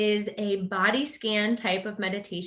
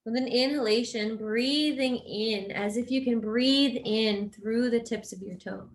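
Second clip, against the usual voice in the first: guided meditation. Real, not acted. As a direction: guide them gently into rest, unhurried and even.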